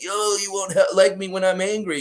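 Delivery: strained pre-transformation threat, hammed up; Bill Bixby. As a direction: warn them as anger takes over. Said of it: "He's like Bill Bixby".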